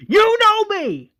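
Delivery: very high, loud acted scream; full-throated retold yell. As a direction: scream it as a desperate insistence. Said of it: "Rick screams".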